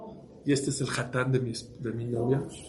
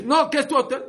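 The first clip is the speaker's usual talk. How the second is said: high, loud startled objection; incredulous protest; 12 semitones higher, 6 dB louder than usual. Acted. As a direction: protest it as if the idea is absurd. Owner protests to stranded family.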